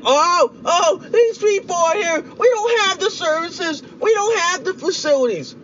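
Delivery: high, whiny mocking imitation of complainers; mock crying. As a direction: wail it in a hammed-up whimper. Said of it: "you cry like a little".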